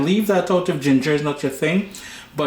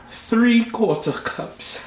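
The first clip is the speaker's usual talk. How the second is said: Trinidadian "Three-quarter cups" with TH as T, slow and high; Trini accent; 9 semitones higher, much slower than usual. Shown as a demonstration. Cook showing viewers his Trini accent, slow and high. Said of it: "That's my Trini accent".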